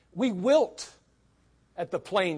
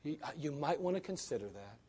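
much softer, tentative suggestion; soft hedging; 5 semitones lower, 13 dB quieter than usual. Shown as a demonstration. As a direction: ease off into a timid hint. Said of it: "No, you better".

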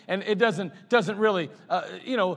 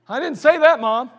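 put-on kid's protest; 5 semitones higher, 9 dB louder than usual. Kid protesting to his mom.

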